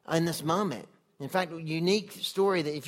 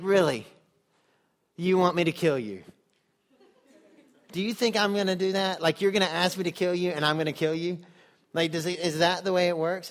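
louder, half-laughing incredulous God; amused disbelief; 5 dB louder than usual. Retold or imitated. God chuckles at Moses asking to die, amused disbelief. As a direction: tease him like a friend who can't believe it. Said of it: "I don't know if God was laughing at Moses".

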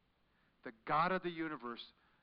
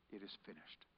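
soft, low, fading; hushed last words; 9 semitones lower, 18 dB quieter than usual. Retold or imitated. Jesus' last words on the cross, soft and low. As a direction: breathe it out like a final word. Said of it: "And then he said this".

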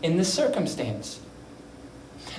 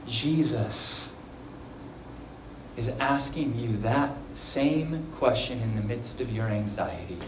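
usual speaking voice

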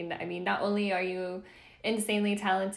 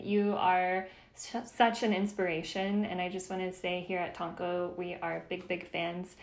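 ordinary delivery

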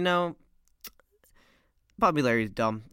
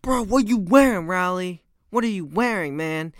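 raised, loud incredulous reaction, easing slightly; mock disbelief; 4 semitones higher, 6 dB louder than usual. Classmates reacting to Rowley's outfit at school, mock disbelief. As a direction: call it out like an appalled classmate.

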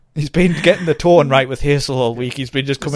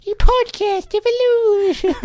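squeaky-high, drawn-out mock announcement; silly title voice; slower than usual. Co-host joins the title tryouts, silly high voice. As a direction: stretch it out in a high cartoonish voice.

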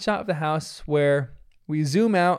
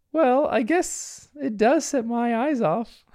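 high, slow put-on lady voice; dad playing mom; 8 semitones higher, slower than usual. Bandit accepts the handbag compliment, mock feminine. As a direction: play along as a hammy mom. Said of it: "Bandit says a hilarious line".

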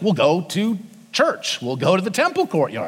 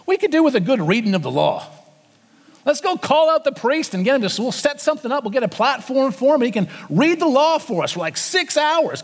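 a light, higher, quicker voice for the keen crowd; eager crowd voice; 6 semitones higher than usual. Pretend. Preacher voicing the crowd asking for the law, eager and higher. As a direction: play the people, bursting with a bright idea. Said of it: "where the people say".

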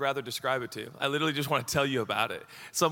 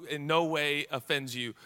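ordinary talk

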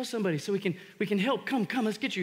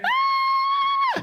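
piercing very high scream, much louder; retold scream; 8 dB louder than usual. Preacher recalling the boy at the pool, shrill loud scream. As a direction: scream like someone suddenly going under. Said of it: "I hear".

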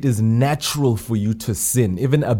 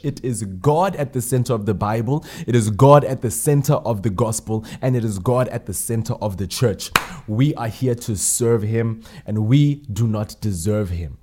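ordinary delivery

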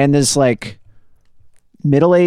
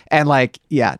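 normal talking voice

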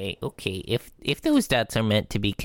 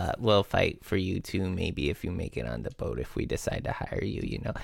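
trailing off quietly; 6 semitones lower, 7 dB quieter than usual. Real, not acted.

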